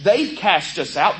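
ordinary talk

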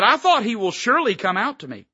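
Naaman's complaint. Retold or imitated. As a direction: play a proud general put out. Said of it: "He said".

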